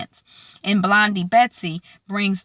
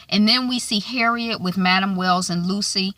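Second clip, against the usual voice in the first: ordinary talk, nothing marked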